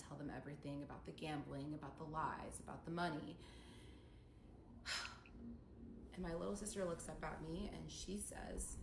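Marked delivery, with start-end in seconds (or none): 3.4-6.1 heavy sigh. Real, not acted. After confessing everything.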